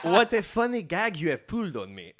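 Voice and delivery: loud, high mock French accent. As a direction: deliver it as a hammed-up Frenchman. French advisor reacting to the disguise.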